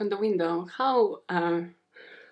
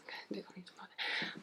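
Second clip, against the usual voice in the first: very soft, quick whisper; whispered aside; 18 dB quieter, faster than usual. Pretend. Host correcting herself off the record, quick whisper. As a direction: mumble it hurriedly under your breath.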